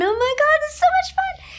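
high, giggling squeal of joy; laughing giddy. Retold or imitated. Grace lying in snow angels, laughing high squeal. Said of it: "And I was laughing".

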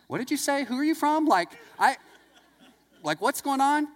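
a mock bewildered what-did-you-say; baffled surprise. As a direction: act out the total confusion. Baffled reaction to her answer.

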